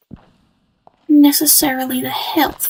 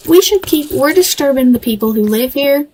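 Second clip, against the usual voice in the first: ordinary delivery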